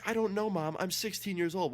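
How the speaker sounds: high-pitched retort to a parent; exasperated protest. Retold or imitated. Son tells mom he is too old, exasperated. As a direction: reenact arguing back, voice pushed well up.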